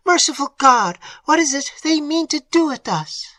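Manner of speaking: high, loud frightened outcry; terrified aunt. A narrator's reading. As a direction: cry out to God in fear. Aunt in terror.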